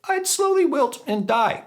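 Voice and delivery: playfully overwrought plea, spoken like a lyric; mock-melodramatic song lyric. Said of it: "that's a song, I didn't sing it".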